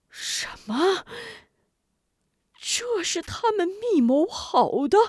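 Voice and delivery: high shocked disbelief; shocked outcry. Acted. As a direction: gasp it out in shock. Shocked at the betrayal.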